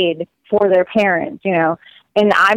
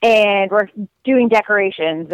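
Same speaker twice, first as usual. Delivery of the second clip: normal talking voice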